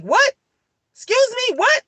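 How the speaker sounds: sudden disbelief at the news; shocked disbelief. Retold or imitated. Mother hearing police found her son, shocked.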